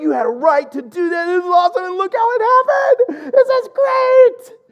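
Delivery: retelling his overjoyed outburst to his friend; ecstatic shout. Retold or imitated. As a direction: shout it out, laughing and almost crying with delight. Overjoyed after the free meal.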